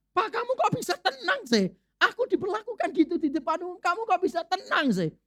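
high-pitched upset wife, mock scolding; wife nags her calm husband after being shamed in public, high and upset. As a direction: play the indignant wife, voice pitched well up.

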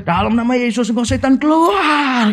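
mock exorcism command rising high on the last word; imitated exorcist yell. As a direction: imitate casting out a demon, pitch climbing without full shouting. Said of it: "Ngusirnya itu teriaknya kencangnya setengah mati".

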